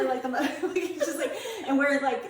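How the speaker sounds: ordinary talk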